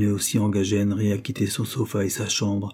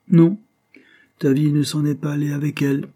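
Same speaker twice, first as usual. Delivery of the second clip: high, emphatic rebuttal with a loud NON; firm contradiction; 6 semitones higher, 5 dB louder than usual. A narrator's reading. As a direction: contradict firmly, pitched up. William firmly contradicts his grieving friend.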